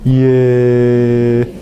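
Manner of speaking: "ye" long drawn, no tongue contact; Y sin contacto. Shown as a demonstration. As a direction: stretch "ye" as an open, gliding sound. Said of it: "No hay contacto". («ye» alargado, sin tocar el paladar)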